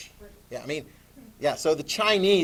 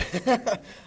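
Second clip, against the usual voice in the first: short laugh; 8 semitones higher than usual. Real, not acted. Laughs at PPE remark.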